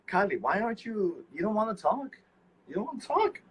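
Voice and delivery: high-pitched mock-hurt pleading; playful coaxing. Playfully coaxing absent Kylie to talk.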